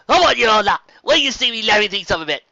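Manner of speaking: loud, high call across the tavern. A narrator's reading. Barmaid calling out to the ponies in the tavern, loud. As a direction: call out to the whole bar. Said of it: "she called out".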